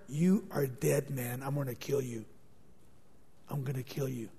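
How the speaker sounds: soft, low menacing threat; murderous hushed vow. Acted. Absalom silently vowing revenge on Amnon, soft and menacing. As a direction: seethe it quietly, full of hatred.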